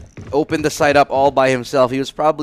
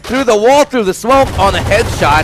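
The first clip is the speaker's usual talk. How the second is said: excited; 7 semitones higher, 5 dB louder than usual. Real, not acted. Caster recaps the big kill.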